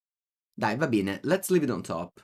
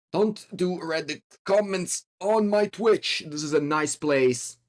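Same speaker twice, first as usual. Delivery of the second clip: high, slow put-on telling-off; playful high-pitched reprimand; mock scolding; 6 semitones higher, slower than usual. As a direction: scold the chat, stretching it out.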